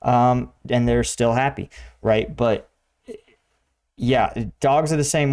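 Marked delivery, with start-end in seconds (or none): none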